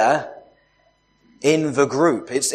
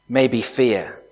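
normal talking voice

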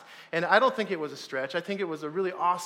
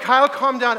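tag; 7 semitones higher, 10 dB louder than usual. Retold or imitated. Acted guide calming screaming climber.

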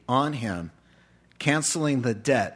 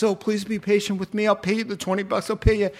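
higher, quick begging; fast pleading; 7 semitones higher, much faster than usual. Retold or imitated. Small debtor begging the servant, high and fast. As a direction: rush out the plea.

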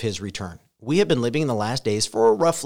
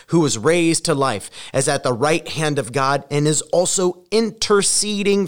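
louder, higher preaching of Romans; preaching scripture; 4 semitones higher, 5 dB louder than usual. Acted. Pastor reading Romans to a scared congregation, full-force preaching. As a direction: proclaim each line to the church.

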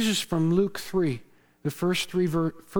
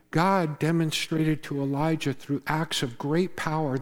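normal talking voice

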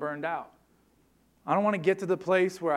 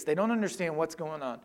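normal talking voice

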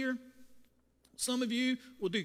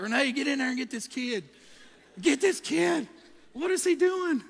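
loud retold cry for help, climbing higher; panicked screaming; 4 semitones higher, 6 dB louder than usual. Crew leader calling Renee into the bathroom, panicked screaming. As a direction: holler for backup in comic panic. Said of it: "I started scr".

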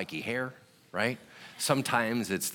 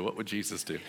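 quieter, with a laugh breaking in; softer laughing repeat; 5 dB quieter than usual. Retold or imitated. Preacher repeats the question to himself, softly laughing. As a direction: say it again more softly, half laughing. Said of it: "And I was like".

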